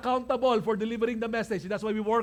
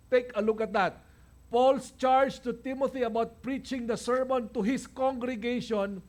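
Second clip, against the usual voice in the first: ordinary talk, nothing marked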